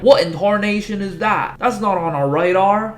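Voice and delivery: high, loud Southern drawl of a startled soldier; a hammed-up Southern gasp at the radar; Benoit Blanc drawl. Military base staff spotting the robot, in a loud Southern drawl. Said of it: "Give it a little bit of Benoit Blanc flavor".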